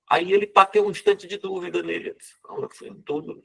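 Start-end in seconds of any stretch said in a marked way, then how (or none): 2.4-3.4 whispered doubt. Acted. Jean Valjean doubting to himself.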